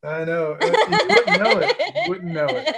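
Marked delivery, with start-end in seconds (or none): 0.6-1.8 high, loud words broken by laughter; laughing tease. Real, not acted. Teasing friend about his accent. 1.8-2.8 high voice, laughter easing off. Real, not acted. Teasing friend about his accent.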